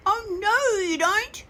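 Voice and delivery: high, loud, defiant chick voice; chick's challenge. Acted. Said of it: "he said".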